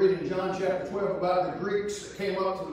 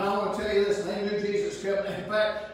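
ordinary talk, nothing marked

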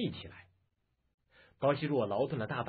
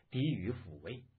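ordinary delivery

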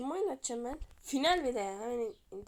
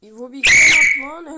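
screaming at a goal, far louder; goal celebration shout; 6 semitones higher, 26 dB louder, slower than usual. Real, not acted. Gamer to his viewers when his striker scores, ecstatic shouting. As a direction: yell it out as the ball goes in.

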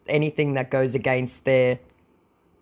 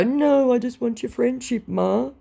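high mock whine; hammed-up wheedling; imitating scammer; 9 semitones higher than usual. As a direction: plead in a put-on, pitched-up voice. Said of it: "Watch him go".

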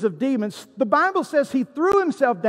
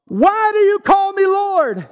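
high, loud, confronting question; loud challenge; 8 semitones higher, 5 dB louder than usual. Acted. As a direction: raise your voice and demand an answer. Repeats Jesus's question as challenge.

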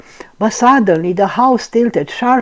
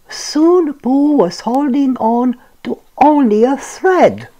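plain reading voice

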